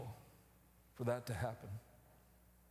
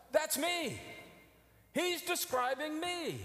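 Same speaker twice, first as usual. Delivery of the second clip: realization; 10 semitones higher, slower than usual. Retold or imitated. Recalling his own realization.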